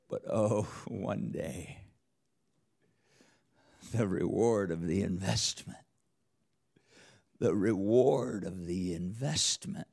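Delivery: a chuckle then hushed reverence; awed, hushed wonder. Acted. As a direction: marvel quietly at the reward. Preacher savoring future reward.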